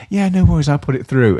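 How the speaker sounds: high, accommodating New Zealand voice; New Zealand lilt. Retold or imitated. Barmaid to a customer with a special order, imitated New Zealand lilt. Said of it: "in that New Zealand, like, lilt".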